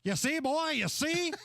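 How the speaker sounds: lightly voiced cartoon rooster, laughing; a hint of a blustery Southern rooster; Foghorn Leghorn impression. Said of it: "sounds very similar to Foghorn Leghorn".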